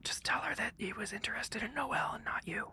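whispered aside; whispering a tip to a fellow player. Real, not acted. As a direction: slip the advice in a hushed whisper. Side advice to other player.

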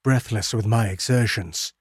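ordinary delivery, nothing marked

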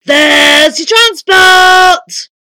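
very slow, high shouted announcement; booming giant. A narrator's reading. Giant wizard shows off his invention, booming. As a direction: bellow it, stretching each word.

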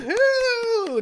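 high excited whoop; cheering. Real, not acted. Welcoming the Dunkle Dame back, a high excited whoop.

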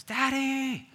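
put-on high, loud little boy calling; child crying out. Father imitating his son calling from bed, high child's cry. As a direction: call out like a small son waking at night. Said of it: "he cries out".

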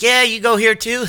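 high, overly bright question; forced cheerful small talk. Retold or imitated. Awkward dad making forced small talk, high and bright. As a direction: fake your friendliness.

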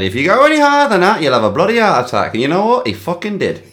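ordinary delivery

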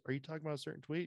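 high, rushed, evasive put-on question; fast mocking press secretary. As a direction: rattle off the dodge in a high mock voice. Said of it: "And she goes".

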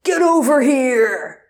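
loud, high, drawn-out roar; long mock-heroic shout; Scorpion battle cry. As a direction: bellow it like a fighting-game catchphrase. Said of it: "And then you hear".

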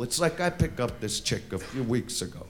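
ordinary talk, nothing marked

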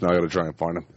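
ordinary delivery

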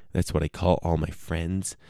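normal talking voice

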